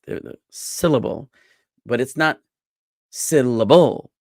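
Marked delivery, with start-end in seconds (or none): 0.5-1.3 "syllable" as SIL-uh-bul, falling away; strong first "si" in "syllable", two weak syllables after; stress on SI. Shown as a demonstration. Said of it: "are de-emphasized". 3.1-4.1 loud, slow "syllable" as SIL-uh-BOOL; stress on SI and BO. Shown as a demonstration. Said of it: "emphasize si and bo and de-emphasize li".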